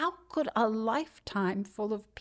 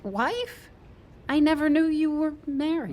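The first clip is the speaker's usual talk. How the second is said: high, louder burst of surprise; surprised; 8 semitones higher, 3 dB louder than usual. Acted. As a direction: caught off guard, blurt it out. Shocked by news of wife.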